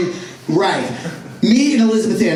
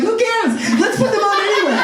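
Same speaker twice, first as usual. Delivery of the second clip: high, laughing, brushing it off; giddy kid; 9 semitones higher than usual. Retold or imitated. Boy waving off the teacher's question, giddy and high. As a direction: wave the teacher's worry away gleefully. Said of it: "I'm like".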